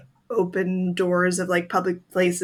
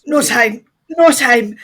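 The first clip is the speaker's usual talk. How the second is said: urgent loud; 4 semitones higher, 7 dB louder than usual. Acted. Character rushing, urgent.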